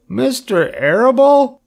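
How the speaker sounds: very high, drawn-out piglet wail; mock sob. A narrator's reading. Wilbur learns Fern's father is in on it, sobbing squeal. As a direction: squeal it as a distressed question. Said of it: "sobbed Wilbur".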